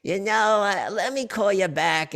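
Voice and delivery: put-on high, slow old lady voice; a dazed grandma stalling the caller. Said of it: "I do my grandma's voice".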